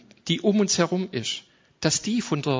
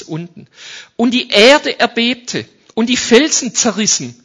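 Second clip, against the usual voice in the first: loud, high, building proclamation; forceful scripture reading; 5 semitones higher, 9 dB louder than usual. Acted. Bible reading, building intensity. (laut verkündend)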